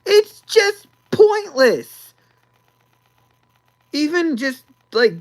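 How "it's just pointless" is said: mock crying whine. Whiny rant about the movie.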